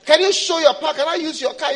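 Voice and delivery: high, loud begging; pleading believer. Acted. Believer begging God for the car, pleading.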